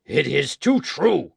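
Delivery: slow, loud, vehement agreement; with fresh violence. Acted. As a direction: pound each word with bitter force. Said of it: "with fresh violence".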